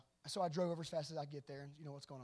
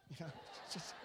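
normal talking voice

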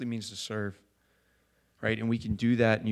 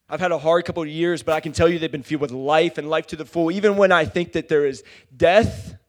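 loud, high, impassioned sermon climax; full-force preaching; 8 semitones higher, 11 dB louder than usual. Real, not acted. Preacher testifying to life after hard years, full-force preaching. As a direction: preach at full voice, building to a peak.

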